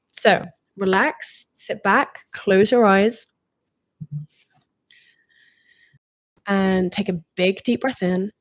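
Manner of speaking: calm guide easing listeners into relaxation; guided meditation. Acted.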